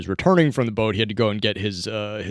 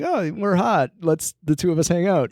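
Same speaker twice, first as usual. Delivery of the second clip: lifted, hammed-up pitch on the flirty line; mock TV flirt; 8 semitones higher than usual. Podcast host mocking the show's flirty couple, hammed-up. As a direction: send up the cheesy TV romance line.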